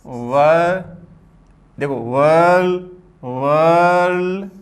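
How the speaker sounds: slow, held-out वर्ल- stopping before the final d; drawn-out sounding out. Shown as a demonstration. Teacher sounds out वर्ल- for students, drawn out slowly. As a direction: stretch the vowel of वर्ल- long into the l, rising. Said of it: "तो क्या गया?".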